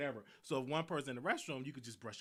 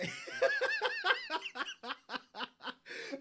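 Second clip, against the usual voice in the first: high, bursting laugh breaking out; big laugh. Real, not acted. Laughing hard at his own roommate story.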